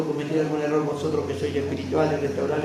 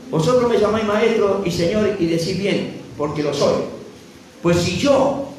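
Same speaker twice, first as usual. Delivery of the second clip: loud, raised scripture proclamation; ringing pulpit reading, high and forceful; full-force preaching; 3 semitones higher, 8 dB louder than usual. Real, not acted. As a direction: proclaim the verses to the whole congregation.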